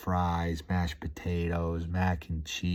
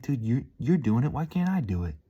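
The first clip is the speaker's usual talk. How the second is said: rising self-pep talk; 7 semitones higher than usual. Retold or imitated. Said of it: "You're like".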